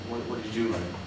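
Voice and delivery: usual speaking voice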